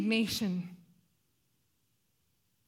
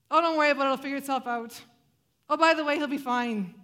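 mock breezy brush-off; 2 semitones higher, 7 dB louder, faster than usual. Mocking a dismissive God.

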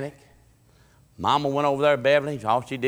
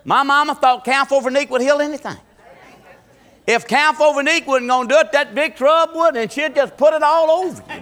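raised, high, loud pulpit voice; full-force preaching; 14 semitones higher, 10 dB louder than usual. Real, not acted. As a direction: proclaim it loudly to the whole church.

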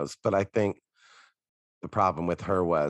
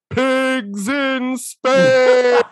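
drawn-out, high, loud shout over a mic; screamed announcement; 12 dB louder, much slower than usual. Retold or imitated. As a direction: yell it slowly like a ride announcer. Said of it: "he screamed".

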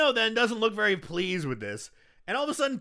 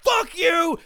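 high, loud mock yell; furious shout; 12 semitones higher, 9 dB louder than usual. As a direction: spit it out as she stomps. Sarah stomps the robot.

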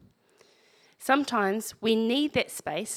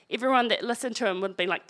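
ordinary talk, nothing marked

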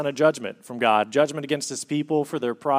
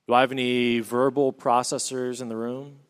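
normal talking voice

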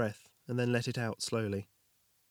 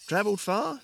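nervous small talk; 9 semitones higher, 4 dB louder than usual. Acted. Jorgin making small talk.

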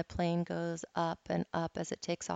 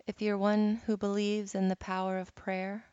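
usual speaking voice

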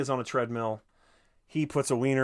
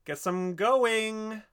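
mock sing-song exit; 11 semitones higher, 5 dB louder, much slower than usual. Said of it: "And it's like".

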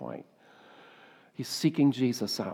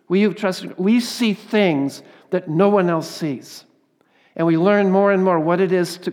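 loud, much higher impassioned preaching; louder, higher full-voiced sermon climax; preaching at full force; 4 semitones higher, 9 dB louder than usual.

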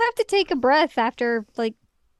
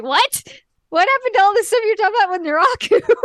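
high, loud, fast disbelief; baffled by the recap skipping the plot; incredulous; 11 semitones higher, 9 dB louder, faster than usual. Retold or imitated. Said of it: "I'm like".

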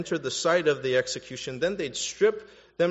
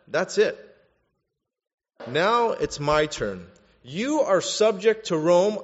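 loud, raised-pitch assertion of authority; forceful retold exasperation, building louder; fed up; 4 semitones higher, 5 dB louder than usual. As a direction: act out a governor laying down the law. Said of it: "He was so fed up".

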